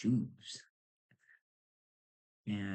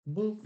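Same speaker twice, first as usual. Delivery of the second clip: high scanner boop; 12 semitones higher, 8 dB louder than usual. Retold or imitated. Imitating scanner beep.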